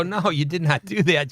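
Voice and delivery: retold disbelief. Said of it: "I go".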